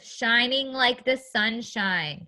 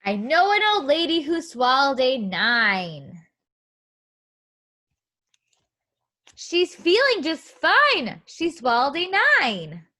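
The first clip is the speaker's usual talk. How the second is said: put-on sing-song read-aloud rhyme for kids; 3 semitones higher, 4 dB louder than usual. Teacher reading a counting book to young children, sing-song.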